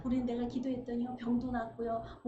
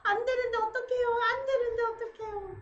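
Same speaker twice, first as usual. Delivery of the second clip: high, sobbing mock plea; tearful plea; 10 semitones higher, 4 dB louder than usual. Believer crying to God at church, high tearful wail.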